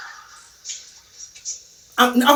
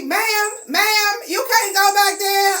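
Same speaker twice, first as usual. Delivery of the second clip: higher, urgent clerk voice; mock clerk; 8 semitones higher, slower than usual. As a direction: call after her to stop. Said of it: "You know, she".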